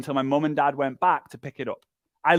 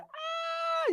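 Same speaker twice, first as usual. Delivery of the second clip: high squeal of a roller coaster scream, thin and breathy; mock scream; 9 dB quieter than usual. Coach acting a rider caught mid-dip, mock squeal. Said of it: "you're like".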